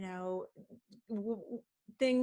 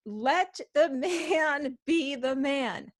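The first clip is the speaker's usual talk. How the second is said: slow, raised, louder pronouncement; weighty advice; 4 semitones higher, 5 dB louder, much slower than usual. Retold or imitated. Acupuncturist advising a wife after a fight, emphatic and slow. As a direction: draw it out like a wise verdict.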